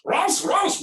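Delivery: "raus" barked high in a put-on German accent; mock German barking. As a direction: bark "raus" like a cartoon German guard. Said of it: "we all grew up with the German accents".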